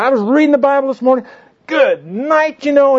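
very high-pitched, fast, hammed-up raving; big show of enthusiasm. Man boasting of his morning Bible reading, wildly put-on excitement. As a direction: imitate a man carried away, voice way up. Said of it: "he put on a big show".

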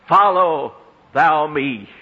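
each word weighted and drawn into a chant; emphatic command. Acted. Preacher quoting Jesus to the congregation, emphatic and chanted. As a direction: press down hard on "Follow thou me". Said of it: "the Greek of that is so emphatic".

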